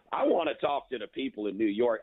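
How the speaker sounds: usual speaking voice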